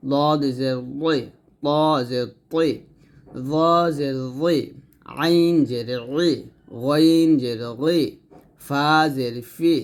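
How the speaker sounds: slow, syllable by syllable; slow drill recitation. Shown as a demonstration. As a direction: recite the letters slowly for students to repeat. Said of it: "তো আবার বললেন".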